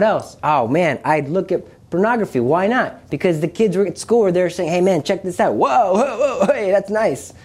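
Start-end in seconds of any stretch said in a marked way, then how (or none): 4.7-7.3 imitating a kid impressed by a find; higher-pitched, wowed quote of a classmate; excited schoolkid. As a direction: act out an awed buddy egging you on. Voicing excited kids at school.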